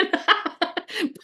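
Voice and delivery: a short high giggle. Real, not acted. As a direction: break into a high little laugh. Laughs at own rant.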